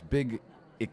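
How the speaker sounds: usual speaking voice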